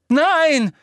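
high, loud cry of no; scream. A narrator's reading. Hermine screaming to stop Umbridge, high and loud. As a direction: shriek it in panic. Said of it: "schrie Hermine".

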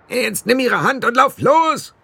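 high, eager little blue man; squeaky fairy barking an order; high pixie voice. A narrator's reading. Pixie chief urging the boy to run, high eager voice. Rob Anybody's voice.

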